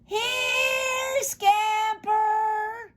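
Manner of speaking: high, drawn-out holler; long, loud call. A narrator's reading. Boy giving one last call for his dog, long high holler. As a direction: sing out a far-carrying call, stretching each word. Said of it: "one last call in such a long, loud".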